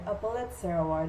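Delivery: usual speaking voice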